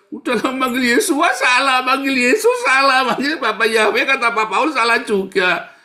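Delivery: high, loud mocking voice of a confused believer; mocking whiner. Mengejek jemaat yang bingung memanggil Tuhan. (suara mengejek tinggi dan keras)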